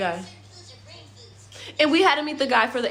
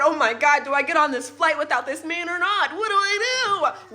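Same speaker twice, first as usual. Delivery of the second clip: panicked; 6 semitones higher than usual. Retold or imitated. Panicking over missing husband.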